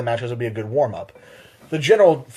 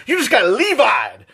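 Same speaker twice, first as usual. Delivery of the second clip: 1 hammed-up gamer taunt; 8 semitones higher, slower than usual. Shown as a demonstration. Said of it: "you can replace".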